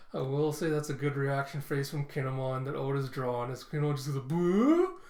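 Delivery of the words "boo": loud, rising "boo"; comic shocked noise. Retold or imitated. Kinemon's reaction face to the question, loud comic shock. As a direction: blurt a drawn-out noise climbing in pitch.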